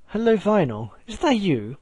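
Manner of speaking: high, lilting British greeting; singsong British accent. A narrator's reading. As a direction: call out sweetly in a British accent. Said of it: "A British accent sang in the hallway".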